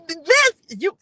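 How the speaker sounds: sudden high, loud burst of excitement; blurting it out, too thrilled to finish; excited outburst. Real, not acted. Reader bursting out over a card, loud and high. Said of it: "I had got excited then".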